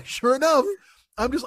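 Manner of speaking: normal talking voice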